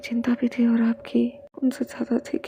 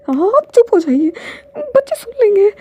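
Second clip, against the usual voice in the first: much higher and louder, close to crying; loud tearful plea; 10 semitones higher, 9 dB louder than usual. Acted. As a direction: beg him to stop, voice cracking into tears. Repeats plea, louder, near tears.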